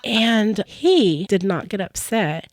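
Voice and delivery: ordinary talk, nothing marked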